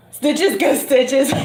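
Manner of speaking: slow, high, laughing delivery. Real, not acted. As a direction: crack up while saying it. Laughing over a funny phrase.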